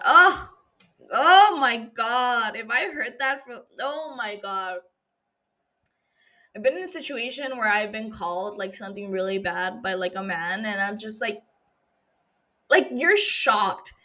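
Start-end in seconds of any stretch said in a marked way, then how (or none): 0.0-2.5 loud, slow, drawn-out disbelief; stunned. Real, not acted. Shocked reaction. 12.7-13.9 stunned tone. Real, not acted. Describing shock.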